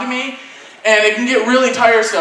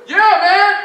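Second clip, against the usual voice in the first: high-pitched stoner-like reply; shady fisherman; 10 semitones higher than usual. Retold or imitated. Man fishing answers passing kid, high put-on voice. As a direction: put on a high, rising stranger's voice.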